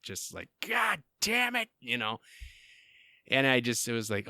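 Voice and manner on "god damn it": higher, slow exasperated curse; dad-instinct curse. Retold or imitated. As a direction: let the curse slip out like a frustrated dad. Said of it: "like a dad instinct".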